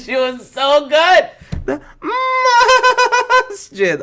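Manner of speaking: high laughing praise; laughing delight. Real, not acted. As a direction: gush through a laugh.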